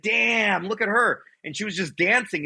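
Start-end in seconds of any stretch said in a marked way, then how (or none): 0.0-1.2 high-pitched admiring reaction; retold amazement. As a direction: marvel at how she looks, higher pitched. Retold admiration at seeing young mother.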